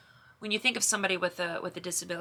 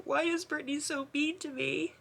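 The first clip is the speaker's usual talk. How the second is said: higher, breaking with tears; nearly crying as she repeats it; tearful; 9 semitones higher than usual. Real, not acted. Sister retelling her brother's hurt question, choked up.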